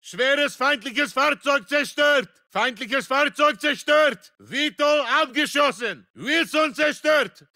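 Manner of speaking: battle shout. Acted. Soldier reporting destroyed enemy vehicles to the squad, shouted.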